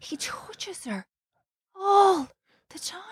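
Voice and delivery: almost whispered, conspiratorial aside; hushed gossip. Real, not acted. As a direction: drop the voice to share the gossip. Scandalised gossip about creepy touching.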